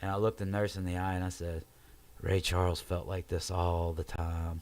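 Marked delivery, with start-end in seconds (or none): 2.2-4.6 slow, slightly lower delivery; retold drugged musing. Morphined patient confides in the nurse, slow and dazed. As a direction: draw the words out lazily, imitating a drugged patient.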